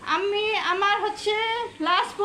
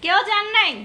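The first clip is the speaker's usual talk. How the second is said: louder impatient call to the chat; 3 semitones higher, 6 dB louder than usual. Real, not acted. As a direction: call out, are you there. Calling out to silent viewers.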